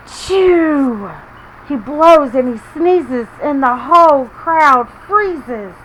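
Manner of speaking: put-on lively read-aloud to children; story reading. A narrator's reading. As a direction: read it like a picture book for little ones. Reading picture book to kids.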